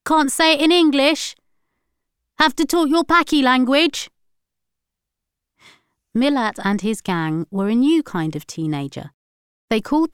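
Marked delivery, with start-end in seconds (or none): 0.0-4.1 loud, high insulting shout. A narrator's reading. Ticket man still yelling after the boys, contemptuous. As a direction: yell the jeer after them. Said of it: "shouted the ticket man".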